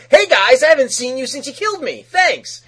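high, loud breezy villain greeting; mock nonchalant. Slade greets the Titans he fought, breezy and mock casual. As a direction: toss it off like nothing happened. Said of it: "Slade acting all nonchalant".

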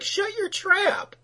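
high, drawn-out mock scolding; firm father. Podcaster voicing the dad to his picking daughter, exaggerated firm scolding. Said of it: "being the firm father".

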